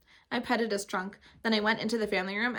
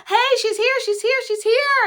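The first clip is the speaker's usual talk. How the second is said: much higher, loud, stretched thrilled yell; a child spotting her ride at last; 14 dB louder, slower than usual. Acted. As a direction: yell it out in pure excitement. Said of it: "I yelled, real thrilled".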